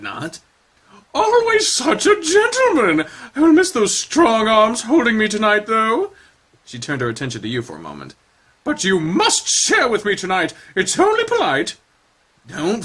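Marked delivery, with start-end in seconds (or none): 1.1-6.1 high, theatrical grande dame; high showy dance teacher; Madame Esperanza. Acted. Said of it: "Madame Esperanza voiced". 8.6-11.8 high, theatrical grande dame; high showy dance teacher; Madame Esperanza. Acted. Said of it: "Madame Esperanza voiced".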